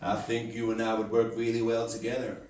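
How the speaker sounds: low-pitched American chat-up voice; smooth, low American come-on; American accent. Acted. American chatting up a girl in a bar, American accent. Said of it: "It could be an American chatting up a girl".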